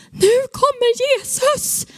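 high, drawn-out cry of joy; excited cry. Retold or imitated. Woman flipping out alone in the woods, excited high cry. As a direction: shout it skyward, high and stretched.

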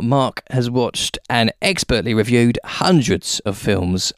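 a light ad-read voice; podcast outro. Acted. Host thanking listeners at show's end, ad read. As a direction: read the sign-off like a promo.

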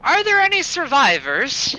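panting after the fight; out of breath. Acted. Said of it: "I'm visibly out of breath".

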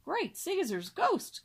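slow, high astonished exclamation; teacher stunned by the swan's wings, crying out. A narrator's reading. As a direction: stretch it out in amazement. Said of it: "cried the teacher".

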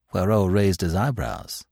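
usual speaking voice

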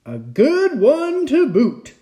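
slow, high, loud chorus of pirates; crew agreeing. A narrator's reading. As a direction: echo the captain in drawn-out agreement. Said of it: "the others agreed".

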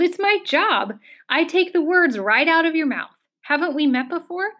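high cackling bird; high-pitched gleeful bird voice. A narrator's reading. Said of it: "the bird cackled".

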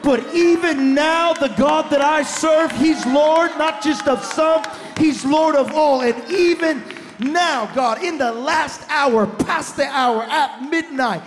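loud, building sermon cry, slow on even now; full-force preaching. Real, not acted. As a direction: preach it out loud to the whole room.

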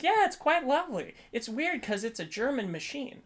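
high, airy nurse reply; imitated nurse. Nurse answers about the voice.

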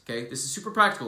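ordinary talk, nothing marked

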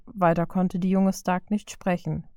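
usual speaking voice

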